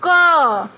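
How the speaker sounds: क held long, loud, with the अ sound ringing; drawn-out consonant. Shown as a demonstration. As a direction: stretch क so the अ can be heard. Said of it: "बोल के देखो". (क को खींचकर, अ की आवाज़ के साथ)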